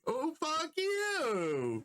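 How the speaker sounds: high, long-drawn comic protest; playful mock outrage. Real, not acted. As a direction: stretch it out high, bantering with a friend. Teased back about gaming during work.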